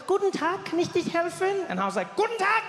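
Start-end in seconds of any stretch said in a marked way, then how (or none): 0.1-1.7 high-pitched, cheery German shop greeting; very high, welcoming German; friendly woman. Retold or imitated. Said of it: "The woman was really friendly". 2.2-2.6 high, loud dictator-style greeting; hammed-up Führer shout. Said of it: "Führer feeling to it".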